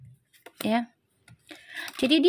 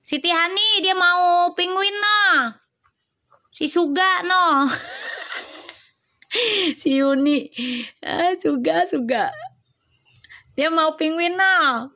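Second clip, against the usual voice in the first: playful teasing; 6 semitones higher, slower than usual. Real, not acted. Teasing chatters' penguin gifts.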